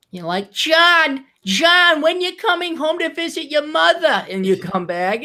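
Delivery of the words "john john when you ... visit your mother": high, exaggerated New York mother; hammed-up New York mom, pitched way up. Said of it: "this T V show New York mom".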